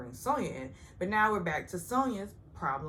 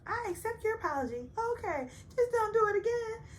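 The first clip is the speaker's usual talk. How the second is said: much higher, sing-song put-on voice; mock prissy apology; 3 dB louder than usual. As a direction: mimic a condescending forgiveness. Mocking the standard show apology.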